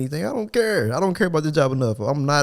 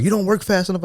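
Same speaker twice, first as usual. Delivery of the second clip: mock boss; 7 semitones higher than usual. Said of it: "and he like".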